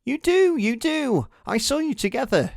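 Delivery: put-on very high, insistent young girl. A narrator's reading. Third girl insisting to Peter, high-pitched. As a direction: pin him down, high and eager. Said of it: "said a third".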